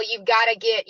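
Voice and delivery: ordinary delivery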